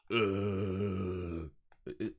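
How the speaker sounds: low groaning zombie voice. Pretend. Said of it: "good zombie impression".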